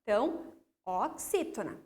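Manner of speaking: normal talking voice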